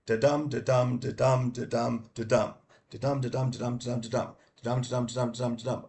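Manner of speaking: da-DUM, da-DUM in a steady beat; chanted da-DUM metre demo, second syllable hit; stress on DUM. Shown as a demonstration. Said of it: "where two syllables, the stress on the set".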